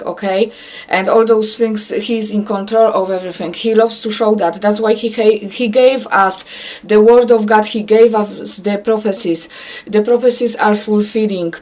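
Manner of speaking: ordinary talk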